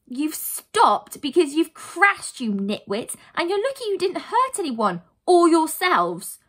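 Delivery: loud, high telling-off; cross cry. Acted. Heroine scolds brothers who crashed, cross cry. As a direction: scold hard, punching the key words. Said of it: "Lucy cried".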